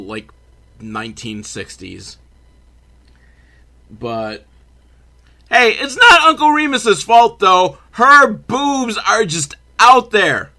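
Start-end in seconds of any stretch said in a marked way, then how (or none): 5.5-10.5 high, loud comic protest; raised voice, pitched way up; mock-indignant outburst. Real, not acted. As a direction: loudly leap to his defence, stretching the end. Mock-indignant defence of Uncle Remus.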